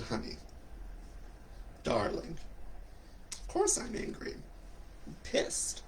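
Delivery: very soft, spaced-out reply; hushed retort. Acted. Speaker answering friend who asks about anger, hushed admission. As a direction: drop to a near whisper, pausing between phrases.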